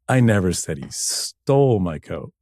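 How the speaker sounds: "stole" stretched out and stressed. Shown as a demonstration. DJ showing ways to say one line, stress on "stole". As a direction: draw out the word "stole". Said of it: "this many ways to say this".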